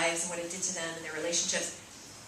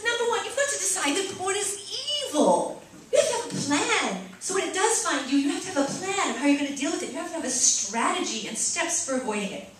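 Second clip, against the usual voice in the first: higher, lightly put-on British accent, fading back toward her own voice; mock British accent; 7 semitones higher than usual. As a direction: keep the bad British lad going a little. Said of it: "this is my terrible British accent".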